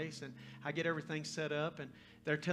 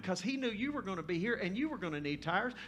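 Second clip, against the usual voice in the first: loud, raised-pitch testimony with driving emphasis; full-force preaching; 8 semitones higher, 7 dB louder than usual. Acted. Preacher telling the girl God arranged it, full-force preaching. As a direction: build it like an impassioned preacher.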